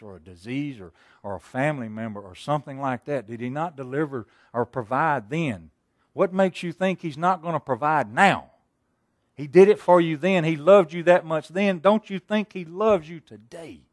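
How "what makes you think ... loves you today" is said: rising, loud preacher's cry to the congregation; preaching loudly. Real, not acted. Preacher pressing the congregation, loud and fervent. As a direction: drive it home, full voice.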